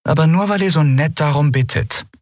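sour, high-pitched concession; Lien. A narrator's reading. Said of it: "lenkte Lien säuerlich ein".

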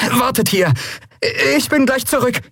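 put-on high, hurried-sounding boy's voice; urgent call. Boy telling companions to wait, urgent high call. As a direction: call back as you dash off. Boy dashes off to shut door.

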